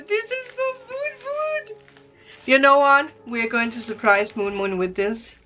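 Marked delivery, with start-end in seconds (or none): 0.1-1.8 high, voice breaking into tears; overwhelmed tearful squeak; crying. Real, not acted. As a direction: let the voice crack with emotion. Opening gifts, moved to tears.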